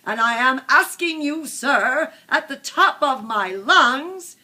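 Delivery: bossy speech building to a shout at the top of the lungs; sharp, bossy Lorax. A narrator's reading. Lorax speaks for the trees to the Once-ler, bossy, building loud. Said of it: "spoke with a voice that was sharpish and bossy".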